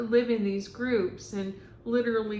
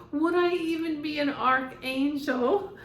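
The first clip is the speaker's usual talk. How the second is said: higher, louder theatrical plea; dramatic archangel voice; 6 semitones higher, 6 dB louder than usual. Retold or imitated. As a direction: ham up a wounded diva. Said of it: "That's how he".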